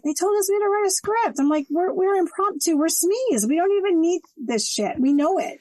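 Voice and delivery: high, insistent mock protest; retold protest.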